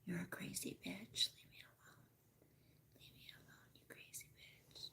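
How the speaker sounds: imitating the baby's grumpy reply in a whisper; hushed mock complaint; lightly voiced whispered retort; whispered dog voice. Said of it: "He's like".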